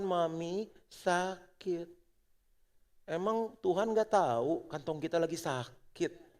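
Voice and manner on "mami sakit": put-on slow, whimpering little child; sick child. Child telling mom she is sick, slow whimper. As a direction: stretch it out like a sick kid. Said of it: "Cuma dengan sebuah seruan".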